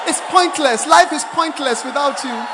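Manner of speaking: hammed-up loud declaration; overblown devotion. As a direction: shout it like a melodramatic lover. Acted overblown declaration of love.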